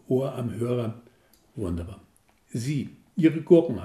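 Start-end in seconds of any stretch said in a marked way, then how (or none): 2.5-3.8 slow, loud flustered blurt; flustered babble. Acted. Caller babbling at the saleswoman about pickles. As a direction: stumble out the words in excitement. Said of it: "haspelte ich".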